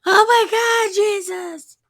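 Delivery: very high, hammed-up shriek; piercingly high fake-frightened scream; mock terrified cry. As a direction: squeal it in comic panic. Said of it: "they cried out in fear".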